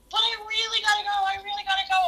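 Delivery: a hint of a desperate little boy begging; pleading kid. Imitating a kindergartner begging his teacher, lightly whiny.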